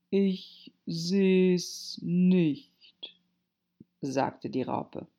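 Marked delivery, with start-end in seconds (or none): 0.1-3.2 extremely slow, drawn-out reply; languid, ponderous caterpillar voice; unimpressed caterpillar. A narrator's reading. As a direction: stretch every word out lazily. Curt caterpillar answers Alice.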